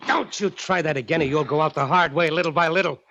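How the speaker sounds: menacing, worked-up warning; angry threat. Acted. Captor threatens after escape attempt.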